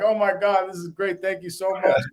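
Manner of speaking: high, loud, imitating her excited thanks; mock gushing girlfriend. Girlfriend thanks the stranger for dessert, high mock gushing. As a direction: play the girlfriend gushing over free dessert. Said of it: "My girl's like".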